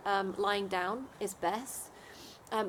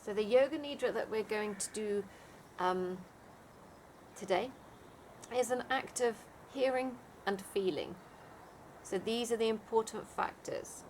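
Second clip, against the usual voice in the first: guided meditation; 4 dB quieter than usual. Acted. Leads a Yoga Nidra meditation.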